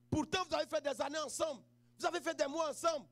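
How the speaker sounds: ordinary talk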